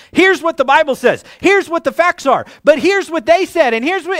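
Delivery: heated, piling up his arguments; frustrated. Retold or imitated. Pastor complains to his wife, in a frustrated rant. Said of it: "I was getting so frustrated".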